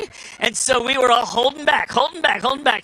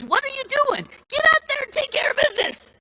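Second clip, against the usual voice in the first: much higher voice, urging his brothers; young David scolding his older brothers; indignant young David. Retold or imitated.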